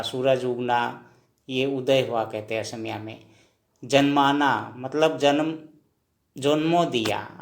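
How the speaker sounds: normal talking voice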